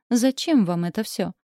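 ordinary delivery

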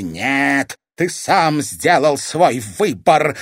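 loud, emphatic refusal; breathed into his face. A narrator's reading. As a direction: throw it in his face, slowing down. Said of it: "выдохнула ему в лицо дама теней".

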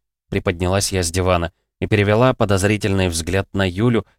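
plain reading voice

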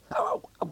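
sharp high yelps of pain as the shoulder is touched; pained cries. Acted. Patient yelping at the doctor's touch, high pained cries.

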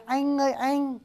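"anh ơi anh" high and soft, with a clear e vowel; Northern pronunciation. Shown as a demonstration. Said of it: "Người Bắc thật ra đọc là E N H".

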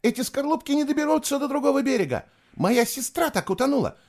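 very high, panicky whine; loud whining. A narrator's reading. Girl afraid of drowning begging off the boat, loud whining. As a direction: wail your objections in a high voice. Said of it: "громко скулила девушка".

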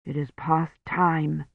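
soft, slow priest's reply; quiet insistence. A narrator's reading. Priest to Kivrin about the bell, soft slow insistence. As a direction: say it slowly, under the voice.

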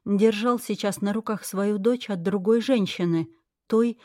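usual speaking voice

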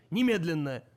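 loud barked order; shouted command. Acted. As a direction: bark it as a final order.

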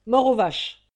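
loud defiant slogan; punchy shouted slogan; defiant cry. Acted. Rallying cry quoted in story.

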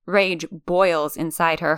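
plain reading voice

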